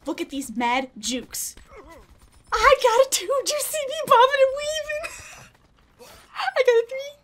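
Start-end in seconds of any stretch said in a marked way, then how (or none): 2.5-7.1 high, squealing brag; giddy, high-pitched triumph; thrilled. Real, not acted. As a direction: crow each kill in a high, excited voice. Streak building, bragging.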